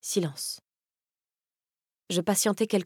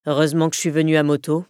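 Kaz's lower voice, flung out louder; a dry, louder jab; tossed off loud; 3 semitones lower, 5 dB louder, faster than usual. Acted. Kaz teasing her as he heads to the bike, louder and lower. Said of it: "me lança-t-il".

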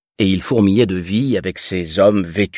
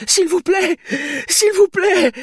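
desperate begging. Acted. Terrified woman keeps begging.

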